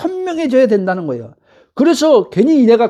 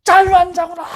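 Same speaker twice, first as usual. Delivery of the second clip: high-pitched cry of joyful realization, volume reined in; elated mock holler, not full volume; held-back shout; 6 semitones higher than usual. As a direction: act out wanting to yell it. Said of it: "라고 막 소리 지르고 싶어요".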